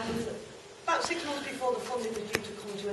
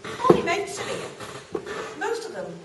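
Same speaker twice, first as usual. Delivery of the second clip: put-on lively, swooping storytelling in a young girl's role; chatty young girl character; 3 semitones higher than usual. Girl showing the audience her street and mates, chatty and animated. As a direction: chat brightly to the audience as a girl reminiscing.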